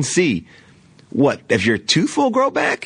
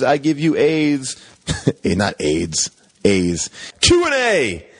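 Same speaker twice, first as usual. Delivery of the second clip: ordinary talk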